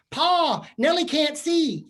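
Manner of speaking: put-on high, loud, pleading little girl; pleading child voice. A daughter pleading with Pa on Little House, high and loud. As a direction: beg Pa like a frantic prairie child.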